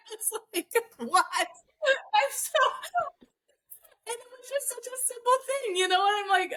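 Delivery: laughing. Real, not acted. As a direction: talk while still laughing at the absurdity. Laughing retelling the simple answer.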